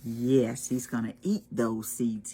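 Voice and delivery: ordinary delivery, nothing marked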